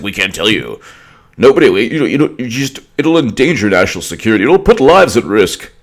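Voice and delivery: hammed-up alarm, pitched high; mock panicked officials. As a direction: mimic flustered officials, voice climbing at the end. Mocks Justice Department's excuse.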